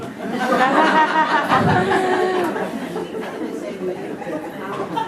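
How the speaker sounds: long, high burst of laughter; cracking up at his own joke. Real, not acted. Rabbi laughing at his own joke during the sermon, a high burst.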